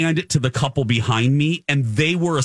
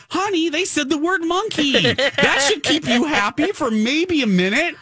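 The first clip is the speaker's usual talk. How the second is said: much higher, sing-song coaxing mock husband; imitating husband; 13 semitones higher than usual. Husband soothing his miserable wife, high sing-song coaxing. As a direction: coax her like soothing a child. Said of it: "He's like".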